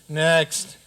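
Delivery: loud, clipped summons; brisk call. Acted. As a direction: wave the next one in. King hurries petitioners along.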